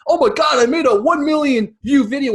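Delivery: high and loud excited gush; mock excitement. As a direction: gush like a thrilled newbie creator. Mocking excited YouTuber.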